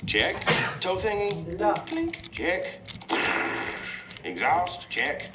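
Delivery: high cartoon truck, rising on each check; Mater. Zapped truck checks his parts, high character voice. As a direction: lift each item like a checklist. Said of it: "And Mater says".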